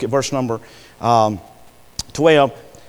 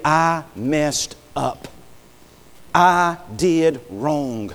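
very slow, heavy admission; slow confession; much slower than usual. Retold or imitated. Admitting wrongdoing to God, very slowly. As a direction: drag each word of the confession.

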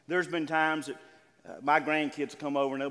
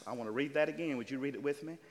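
ordinary delivery

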